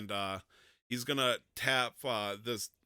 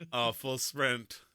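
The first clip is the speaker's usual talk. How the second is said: ordinary talk, nothing marked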